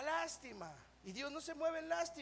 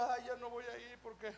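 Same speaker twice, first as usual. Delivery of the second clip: mimicked excuse-makers; 2 semitones lower, 7 dB quieter than usual.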